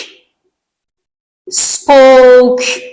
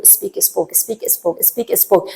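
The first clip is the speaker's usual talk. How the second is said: softly repeating speak and spoke under the breath; quiet drilling; 4 semitones lower, 12 dB quieter, much slower than usual. Shown as a demonstration. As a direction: murmur the pair over and over to memorise it. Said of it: "یه دونه رو نگه دار تو ذهنت". (تکرار آرام و زیر لب speak و spoke)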